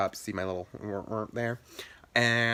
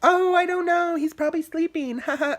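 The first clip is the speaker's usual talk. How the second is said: much higher, louder fake-innocent child voice; innocent kid; 5 dB louder than usual. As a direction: play dumb to the parents, sweetly. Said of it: "I probably was like".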